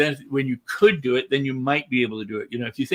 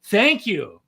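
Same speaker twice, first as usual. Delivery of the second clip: enthusiastic; 5 semitones higher, 3 dB louder than usual. Shown as a demonstration. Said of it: "you go".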